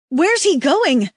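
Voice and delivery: desperate cry. A narrator's reading. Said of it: "the woman cries, desperate".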